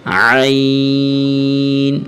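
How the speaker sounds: long throaty عین; drawn-out ain. Shown as a demonstration. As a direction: stretch the عین slowly. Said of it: "عین کو تھوڑا سا کھینچ کے".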